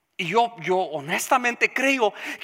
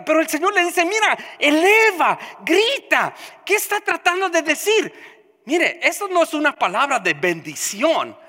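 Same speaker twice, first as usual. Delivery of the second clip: preaching at full force; 8 semitones higher, 6 dB louder than usual. Acted. Pastor exhorting the congregation, preaching at full force. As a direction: pound out each phrase like a revival preacher.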